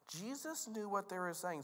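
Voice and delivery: ordinary talk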